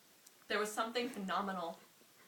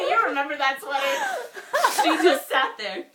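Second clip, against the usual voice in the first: squeaky high laughter breaking through the words; can't get the line out for laughing; 10 semitones higher, 16 dB louder, slower than usual. Real, not acted. Embarrassed by her old story, cracking up.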